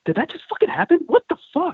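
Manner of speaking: very high-pitched incredulous outburst; squeaky mock astonishment; retold stunned disbelief. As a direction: act out being flabbergasted, voice climbing high. Retold shock as stranger stumbles away.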